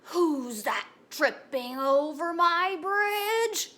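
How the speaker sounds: slow, drawn-out troll roar, rising as it goes; big menacing troll voice; storybook troll. Acted. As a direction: challenge the goat as a troll, loud and stretched out. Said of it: "what does the troll say".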